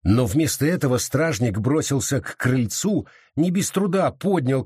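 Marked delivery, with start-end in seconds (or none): none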